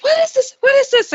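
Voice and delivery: high, loud, frightened repeat, voice climbing; shrieking in alarm at the screen; panicked shriek. Retold or imitated. Sister panicking at strange message.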